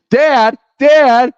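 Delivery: high, loud mock cry for Dad; mock-panicked plea, voice way up; playing a downed driver. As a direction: call out for help, hammed up. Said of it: "then Ricky is on the ground".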